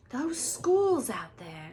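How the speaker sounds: lightly voiced Fred calling out. A narrator's reading. Fred peering out door.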